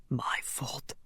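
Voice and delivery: barely audible broken whisper; guilty whisper. Acted. Connor doubled over with guilt, a faint whisper. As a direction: breathe the words out to yourself.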